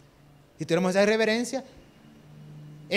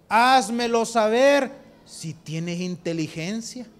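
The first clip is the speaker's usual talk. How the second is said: loud, slow, stern challenge; booming voice of God; 4 semitones higher, 6 dB louder, much slower than usual. Retold or imitated. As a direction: thunder it slowly like God rebuking. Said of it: "y le dice". (voz de Dios, fuerte y lenta)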